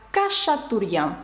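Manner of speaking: "Кашатурян" with K and SH, no kh or ch; French pronunciation. Shown as a demonstration. Teacher giving her surname as the French say it, K and SH. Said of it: "французи вимовляють як".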